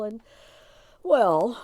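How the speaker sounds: ordinary delivery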